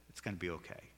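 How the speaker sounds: low, very soft comforting voice; gentle reassurance. Acted. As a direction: quietly reassure someone downcast. Comforting someone downcast, lifting their head.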